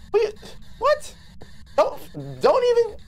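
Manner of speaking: squeaky high disbelieving cry; real startled high-pitched protest; shocked outburst. Real, not acted. Reacting to ghost.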